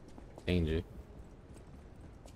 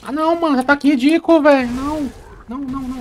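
high-pitched exasperated wail; frustrated high outburst; 7 dB louder than usual. Real, not acted. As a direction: whine at the ridiculous attack. Boss attack frustration outburst.

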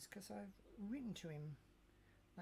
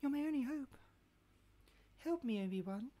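high, loud pleading movie quote; hammed-up desperate plea, much higher; princess plea impression; 8 semitones higher, 8 dB louder, faster than usual. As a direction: beg for rescue like a film princess. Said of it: "That's what I was trying to do. Could you tell?".

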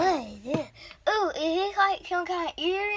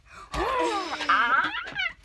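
shouted gasp. Pretend.

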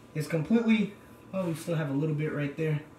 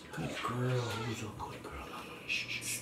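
soft soothing praise; 3 semitones lower, 11 dB quieter than usual. Real, not acted. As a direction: coo softly to a hurt dog. Comforting the dog softly.

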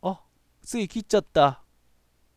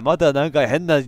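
usual speaking voice

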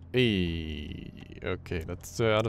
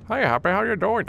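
mock cheery fish voice; 11 semitones higher, 7 dB louder than usual. Voicing Happy the fish.

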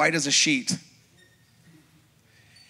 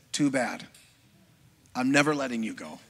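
lower, quieter flat refusal from the boss; a lightly voiced boss, lower and quieter; cold refusal; 5 semitones lower, 4 dB quieter than usual. Boss refuses to let him quit.

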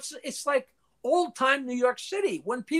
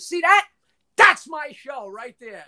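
retold excited claim; 4 semitones higher, 6 dB louder than usual. Points at schedule blank spot.

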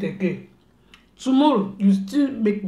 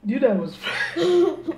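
higher voice breaking into laughter; laughing; 7 semitones higher than usual. Real, not acted. Mother revealing the prank to child, laughing. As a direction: laugh through the question.

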